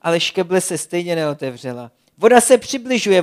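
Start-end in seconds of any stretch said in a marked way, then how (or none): 2.2-3.2 higher, louder worn-out call; exhausted Blesk warns his friends about the tide. Acted. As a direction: call the warning though you're spent. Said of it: "volal naprosto vyčerpaný Blesk".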